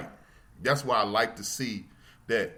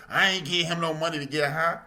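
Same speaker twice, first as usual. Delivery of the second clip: mocking a stingy passerby; 6 semitones higher, 3 dB louder than usual. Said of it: "all this nonsense".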